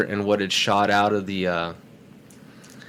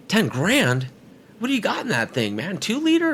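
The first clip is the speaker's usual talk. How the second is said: incredulous buyer; 11 semitones higher than usual. Retold or imitated. Buyer balks at the price, a high mock disbelief. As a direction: voice jumping much higher in mock disbelief. Buyer haggling over the car.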